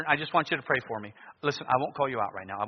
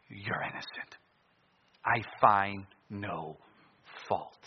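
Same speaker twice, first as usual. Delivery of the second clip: soft whisper of a verdict; 3 semitones lower, 3 dB quieter, much slower than usual. Acted. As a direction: breathe the words gently.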